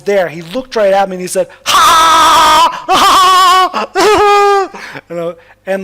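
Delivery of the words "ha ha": very loud, high-pitched "Ha!" bursts; loud imitated outburst. Storyteller imitating the young man who looked right at him, explosive high shouts. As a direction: belt out huge high cackling shouts.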